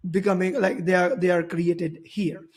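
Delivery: normal talking voice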